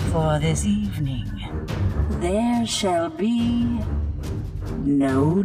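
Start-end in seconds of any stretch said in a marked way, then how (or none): none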